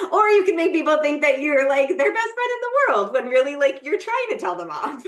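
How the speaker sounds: laughing at the irony. Real, not acted. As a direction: talk through the giggles. Laughing while explaining.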